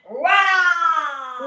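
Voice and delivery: loud, slow release roar; roaring shout. Shown as a demonstration. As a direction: let it out in a big roar. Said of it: "jump and rah".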